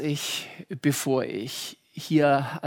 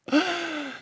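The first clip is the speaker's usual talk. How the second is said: high mock laugh; 11 semitones higher than usual. Punchline groan before Nein.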